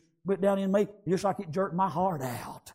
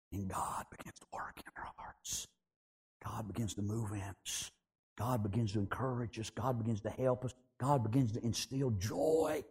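soft, low, near-whispered sermon; hushed preaching; 6 semitones lower, 9 dB quieter than usual. Acted. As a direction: draw them in with a hush. Preacher drops into hush.

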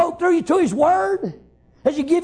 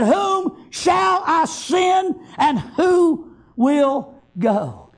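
very slow, word-by-word pulpit quote; solemn question; 3 semitones higher, much slower than usual. Acted. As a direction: draw out each word, letting the last fall away. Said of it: "it wasn't a commanding voice".

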